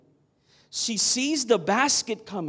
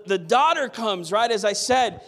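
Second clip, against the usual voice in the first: normal talking voice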